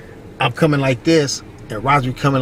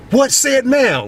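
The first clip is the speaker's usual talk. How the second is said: confronting; 12 semitones higher, 4 dB louder, much slower than usual. Retold or imitated. Confrontation quote.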